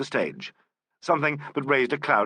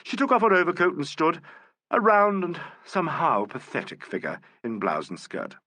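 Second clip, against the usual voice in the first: ordinary delivery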